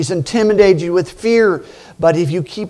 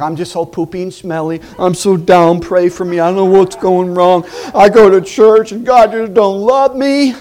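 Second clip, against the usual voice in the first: mock whining; 3 semitones higher, 6 dB louder than usual. Whiny churchgoer complaining at work.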